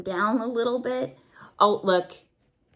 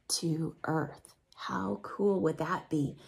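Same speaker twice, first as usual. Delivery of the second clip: hushed, awed whisper; 6 semitones lower, 8 dB quieter than usual. Acted. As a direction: breathe it out in wonder. Quiet awe at the view.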